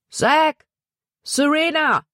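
high, urgent shout of names; calling out. A narrator's reading. Paul running back for Zack and Serena, urgent high call. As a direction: call out across a distance, pitch way up. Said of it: "rief er".

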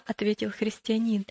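plain reading voice

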